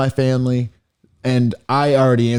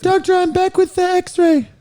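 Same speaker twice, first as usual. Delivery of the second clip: squeaky falsetto nurse, far higher; put-on nurse voice. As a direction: play the nurse in an exaggerated high voice. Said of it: "We'll have to do voices".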